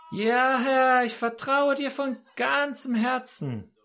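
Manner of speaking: high, slow, drawn-out acted vow; fervent call upward. Hanging man swears trust to God above, high fervent call. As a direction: cry your trust up to heaven.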